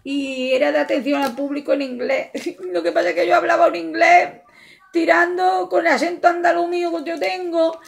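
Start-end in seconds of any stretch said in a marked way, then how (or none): 2.3-7.2 laughing. Real, not acted. Laughing at her own English.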